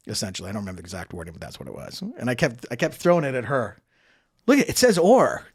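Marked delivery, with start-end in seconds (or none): 4.5-5.4 raised, insistent voice; insistent retort. Retold or imitated. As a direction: push the point hard and loud. Arguing with her for days.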